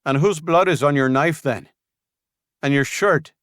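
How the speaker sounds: high, loud challenging question; Rodriguez confronting. Acted. Scout confronting Julian with the knife, loud. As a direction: shove the evidence at him.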